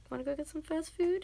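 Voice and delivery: high, rising invitation; luring. Acted. Showing how to lure men with fast food, luring. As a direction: dangle it as a tempting offer. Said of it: "That's how you lure your men".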